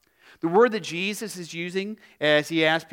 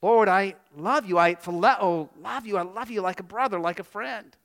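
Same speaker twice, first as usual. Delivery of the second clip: higher-pitched reply, fading at the end; earnest Peter; 3 semitones higher than usual. Acted. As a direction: start insistent, then soften into a humble admission. Acting Peter's second answer to Jesus.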